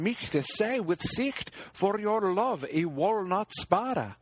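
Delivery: a hint of a young knight pledging loyalty.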